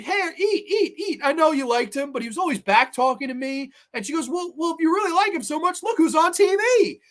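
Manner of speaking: imitating the killer taunting the final girl; mocking Angela. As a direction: tease her like a cruel camp counselor.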